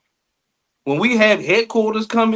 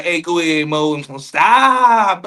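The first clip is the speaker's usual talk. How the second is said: drawn-out, exaggerated proper-talk imitation; mock an affected school way of talking; mimicking proper talk; 3 dB louder, slower than usual. Mimicking how little cousin might talk at school, exaggerated proper. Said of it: "I don't want her talking like that".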